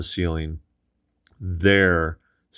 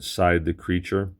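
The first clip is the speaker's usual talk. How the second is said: ordinary delivery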